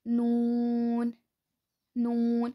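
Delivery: नून slow, tongue edges to the side molars, nasal n; नून: long oo, ringing n; Arabic noon. Shown as a demonstration. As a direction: draw नून out slowly. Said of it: "नून अदा होता".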